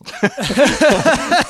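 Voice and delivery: bursts into a high, gleeful laugh; a giddy fan's laugh; high delighted laugh. Real, not acted. Podcaster laughing at a favourite line.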